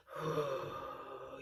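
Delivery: low hum. Shown as a demonstration. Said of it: "you'll feel the vibration down there".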